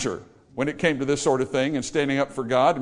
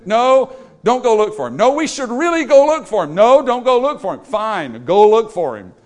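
mock argument; 5 semitones higher, 6 dB louder than usual. Acted back-and-forth quarrel.

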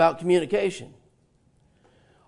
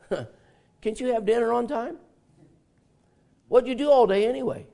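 nagging complaint; 5 semitones higher than usual. Retold or imitated. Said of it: "these things could hurt".

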